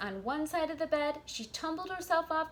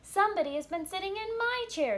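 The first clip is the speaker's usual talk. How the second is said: higher and louder mama bear; lightly voiced mother bear, raised; middle-sized bear voice; 9 semitones higher, 5 dB louder than usual. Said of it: "in her middle-sized voice".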